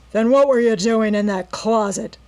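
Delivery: loud menacing robber demand; rough interrogation. A narrator's reading. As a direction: demand it with a threat.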